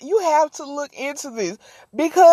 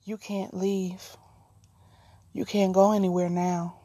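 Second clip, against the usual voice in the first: low menacing near-whisper of a captor; hushed creepy captor voice; creepy quiet threat; 6 semitones lower, 6 dB quieter than usual. Retold or imitated. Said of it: "standing over you talking about some".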